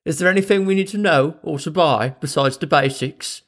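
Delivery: put-on over-the-top Mary Poppins Cockney accent; bad Cockney accent. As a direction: ham up a fake Cockney accent.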